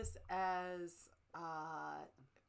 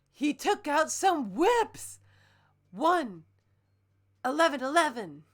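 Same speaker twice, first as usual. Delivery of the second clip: higher, louder, building hype; excited reading; 6 semitones higher, 12 dB louder than usual. Acted. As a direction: hype the ending up. Climax of read fic.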